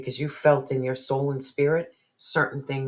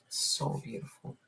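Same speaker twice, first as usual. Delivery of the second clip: whisper; 10 dB quieter than usual. Real, not acted. Moved aside.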